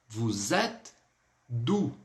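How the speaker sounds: "Vous êtes" linked with a Z, pause before "d'où"; measured "Vous êtes ... d'où?", open E in "êtes"; liaison demonstrated. Shown as a demonstration. Teacher explaining the liaison to pupils, careful separated pronunciation. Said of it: "pronunciando um som de Z".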